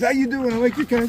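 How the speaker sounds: high sing-song pet voice. Real, not acted. Greeting dogs at party.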